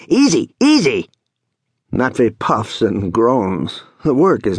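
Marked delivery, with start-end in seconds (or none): 0.1-1.1 high, excitable call; sharp foreman's warning; urgent warning. Acted. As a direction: call out a bossy caution, pitched high. Seryoshka shouts warning to helper.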